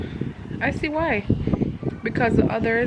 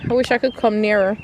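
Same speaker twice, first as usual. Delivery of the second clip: ordinary talk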